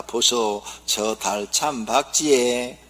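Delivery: Gyeongsang wife asking. Acted. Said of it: "그래서 말합니다".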